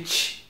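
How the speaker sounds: "tch" as in Portuguese "tchau"; isolated "tch" for demonstration; tch sound. Shown as a demonstration. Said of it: "do tchau".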